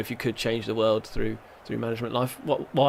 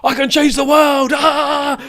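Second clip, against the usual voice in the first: much higher and louder, mock hyped yell; imitating a fired-up player; 13 semitones higher, 8 dB louder, slower than usual. Mimicking his player's sudden wild excitement. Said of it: "to being like".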